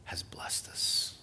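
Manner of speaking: slow hushed whisper; whispered blessing. Real, not acted. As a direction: let it fade to a reverent whisper. Whispered close.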